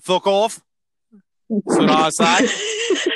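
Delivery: mock-dismissive Antipodean accent; cheeky New Zealand-ish accent, drawn out at the end. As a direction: brush it off in a put-on Aussie-Kiwi accent. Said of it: "it kinda sounds New Zealand".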